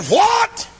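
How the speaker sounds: sudden high, loud cry of disbelief; a startled young preacher's outcry; shocked what. Retold or imitated. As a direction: blurt it out stunned. Shocked preacher's reply, retold.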